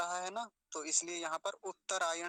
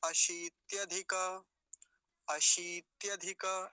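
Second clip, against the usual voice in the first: slow but joined up; measured, connected demonstration; flowing model; much slower than usual. Shown as a demonstration. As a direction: say the word in one careful flow. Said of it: "एक बार प्रवाह से".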